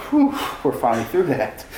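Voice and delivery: imitated relieved sigh; sigh of relief. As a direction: blow out a mock "whew" of relief. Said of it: "giving a sigh of relief".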